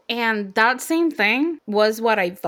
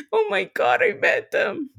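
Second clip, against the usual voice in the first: higher, teary retold disbelief; retold crying; 10 semitones higher than usual. Fan retelling crying after meeting the band, mock tearful. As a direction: relive the tearful moment, lightly. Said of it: "I started crying".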